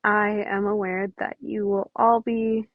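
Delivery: usual speaking voice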